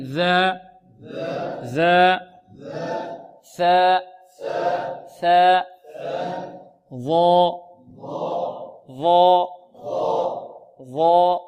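isolated ذا، ثا، ظا with tongue at the upper teeth; tongue-tip interdentals. Shown as a demonstration. Teacher leading repeat-after-me, interdental letter drill. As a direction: drill long syllables, light ذا and ثا, heavy ظا. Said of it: "انطقوا معي".

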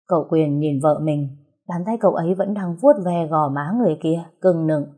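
ordinary delivery

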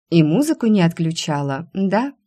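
usual speaking voice

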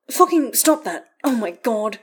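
high, loud irritated outburst; exasperated protest. A narrator's reading. Astarion slapping Karlach's hand away, exasperated. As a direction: snap at a friend to stop.

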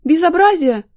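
high, loud indignant cry; outraged exclamation. A narrator's reading. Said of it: "воскликнула Любовь Марковна".

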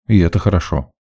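usual speaking voice

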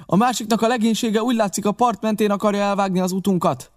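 high, pressing voice; urgent report. Acted. Narrator warning captain of the cut-off, urgent and high.

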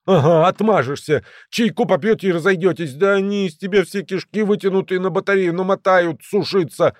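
high, heated scoffing; sarcastic protest. A narrator's reading. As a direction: scoff in agitation at his plan. Agitated friend sarcastically objects.

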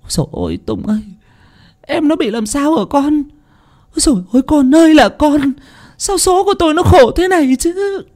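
mother crying over her injured daughter; wailing. Acted. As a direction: wail like a grieving mother. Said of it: "nắm tay Tùng khóc lóc".

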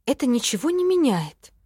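mildly strict telling-off; stern. A narrator's reading. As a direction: say it with a touch of severity. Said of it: "строго сказала я".

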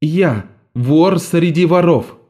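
slow, high, defiant answer; defiant girl. A narrator's reading. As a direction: answer boldly, proud thief. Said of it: "ответила Арета".

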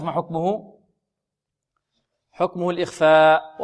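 ordinary talk

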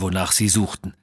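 ordinary delivery, nothing marked

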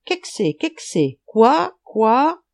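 frog calls jumping high and loud on quoi; frog croaking. A narrator's reading. Frog watching Schultz sink in the river, croaking. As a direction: croak it in pairs, the last two rising sharply. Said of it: "Une grenouille le regarda et coassa".

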